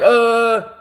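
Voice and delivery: imitated cry on being tasered; sustained high yelp of shock, acted out; shocked groan.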